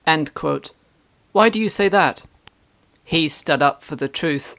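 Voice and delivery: usual speaking voice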